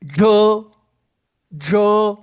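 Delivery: jo loud, nasal vowel, curled tongue; model syllable. Shown as a demonstration. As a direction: belt out the model jo. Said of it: "como é que fica a minha boca". (jo alto e claro como modelo)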